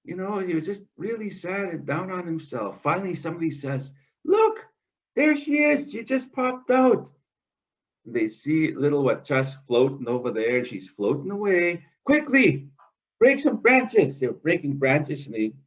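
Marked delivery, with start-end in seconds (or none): 4.2-7.1 excited shout. Acted. Animal on the tree spotting the floating muskrat, excited high call. 12.0-14.2 urgent call. Acted. Urging others to rescue.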